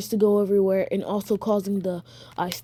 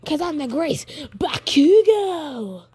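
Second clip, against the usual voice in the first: boastful Bakugo; 7 semitones higher, 3 dB louder than usual. Acted. Storyteller playing Bakugo declaring himself the great one, boastful. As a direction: crow your own name like a champion.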